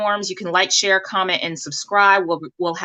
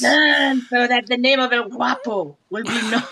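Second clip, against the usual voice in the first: higher hammy Mexican bandit voice; Mexican villain accent; 3 semitones higher than usual. Retold or imitated. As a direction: ham up El Guapo's line, gloating. Quoting El Guapo from the movie.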